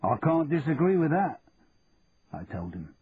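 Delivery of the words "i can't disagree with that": put-on Cockney accent. A narrator's reading. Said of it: "dropping back into my Cockney accent".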